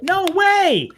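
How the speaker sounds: shot up high and loud in disbelief; can't believe it, real delight; amazed. Real, not acted. Host hearing about the Toyota deal, amazed. Host reacts to big news.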